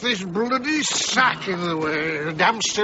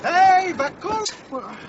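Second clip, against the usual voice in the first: calling out; 7 semitones higher, slower than usual. Acted. Calling out to Vakula in street.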